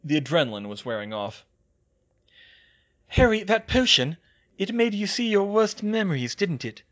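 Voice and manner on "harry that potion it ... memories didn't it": high, loud concerned character; Hermione's voice. A narrator's reading. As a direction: start raised, settle toward the closing question. Hermione questions Harry, voiced by narrator.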